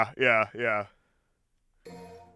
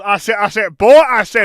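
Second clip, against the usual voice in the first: Foghorn Leghorn; 6 dB louder than usual. Shown as a demonstration. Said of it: "someone that talks like this".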